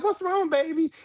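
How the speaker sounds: sweet concern. Retold or imitated. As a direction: soothe her gently. Repeats her comforting question.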